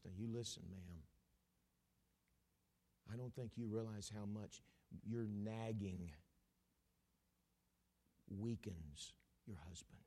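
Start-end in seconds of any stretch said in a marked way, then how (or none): 5.0-10.0 low, slow unvoiced whisper. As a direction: lean in and whisper it. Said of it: "preacher drops to whisper".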